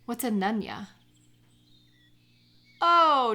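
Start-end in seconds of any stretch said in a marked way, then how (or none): 2.8-3.3 loud, high "oh" of realization; sudden realization. Acted. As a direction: catch the joke all at once. Getting the nunya joke.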